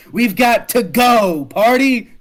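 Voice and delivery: loud, drawn-out rallying yell; excited cheer. Acted. Cade rallying the party, excited yell. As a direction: whoop it out to gather everyone.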